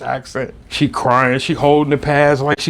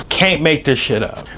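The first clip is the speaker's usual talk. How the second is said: usual speaking voice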